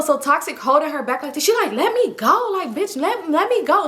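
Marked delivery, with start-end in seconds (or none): 1.7-3.9 high-pitched mock struggle; indignant, high-voiced protest; imitated angry protest. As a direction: demand release, imitating an annoyed friend. Imitates friend struggling to get free.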